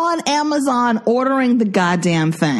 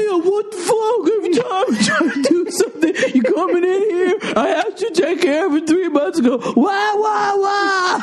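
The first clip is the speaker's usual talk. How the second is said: high, shrill mock sobbing and yelling; fake-cry the complaint, then shout; hammed-up crying rant; mock crying husband; 9 semitones higher than usual. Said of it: "your version of me".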